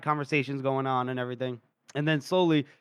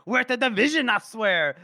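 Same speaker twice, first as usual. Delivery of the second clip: tipsy, high-pitched gushing; drunk colonel; 4 semitones higher than usual. Retold or imitated. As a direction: swear it with drunken fervour. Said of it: "and he's, like, drunk".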